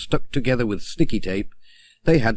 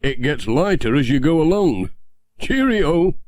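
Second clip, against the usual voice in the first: higher, louder jokey farewell; Ted's voice; 5 semitones higher, 4 dB louder than usual. Acted. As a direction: wave him off brightly, climbing to a high cheerio. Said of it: "he said".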